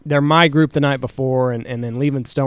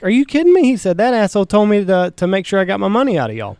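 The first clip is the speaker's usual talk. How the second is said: outraged disbelief; 6 semitones higher, faster than usual. Retold or imitated. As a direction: play the guide hearing he got stiffed. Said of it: "And he's like".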